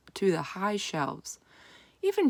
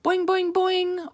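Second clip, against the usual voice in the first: very high, louder twanging frog; excited boing; 13 semitones higher, 4 dB louder, slower than usual. A narrator's reading. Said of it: "Og sounded excited".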